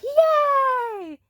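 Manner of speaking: very high, loud drawn-out squeal of joy; ecstatic child. Retold or imitated. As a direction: cheer like an overjoyed four-year-old. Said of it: "Ecstatic as fuck".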